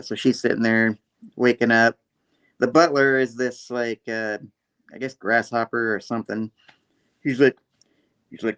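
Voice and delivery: ordinary delivery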